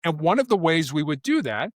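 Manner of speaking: normal talking voice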